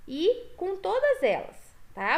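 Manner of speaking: ordinary delivery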